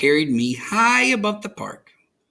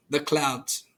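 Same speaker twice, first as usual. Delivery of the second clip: usual speaking voice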